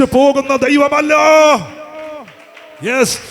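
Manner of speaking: rally the congregation with full force; fiery preaching. Real, not acted. Preacher rouses the congregation to pray, shouting with fervour.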